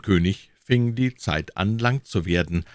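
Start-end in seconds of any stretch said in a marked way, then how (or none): none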